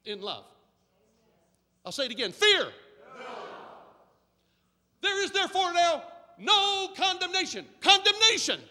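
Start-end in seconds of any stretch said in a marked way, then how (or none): 2.3-8.6 higher and louder, drawing out the line; preaching at full force. Acted. Preacher to the congregation, louder and higher in proclamation. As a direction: proclaim it to the church.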